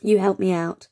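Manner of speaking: ordinary talk, nothing marked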